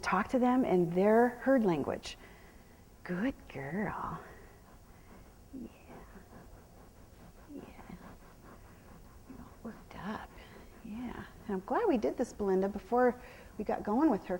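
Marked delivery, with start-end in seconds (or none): 3.0-7.6 whispered praise. Real, not acted. Trainer soothing her horse, whispered praise. 9.3-11.2 soothing whisper. Real, not acted. Calming worked-up horse.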